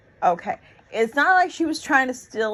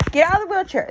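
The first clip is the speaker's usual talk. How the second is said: officer's curt order; 8 semitones higher, 3 dB louder, much faster than usual. Retold or imitated. Retells police ordering her out.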